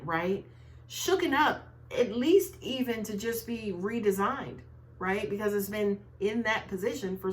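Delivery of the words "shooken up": "Shooken up" repeated high and pressed; stressed repeat. Real, not acted. Repeating her odd word to viewers, "Shooken" stressed high. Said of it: "kind of shooken up".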